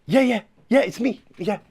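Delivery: usual speaking voice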